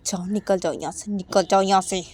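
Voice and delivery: furious mother yelling him out, building to a loud shout; from cold scolding to a full shouting fit; angry scolding. Retold or imitated. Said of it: "अम्मा बोलती कि".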